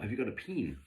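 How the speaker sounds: Kiwi vowel, "peen" with a tight ee for pen; mock Kiwi asking for a "peen" with ee; New Zealand accent. Mimicking a Kiwi friend asking for a pen, New Zealand accent. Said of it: "they pronounce their E's as I's".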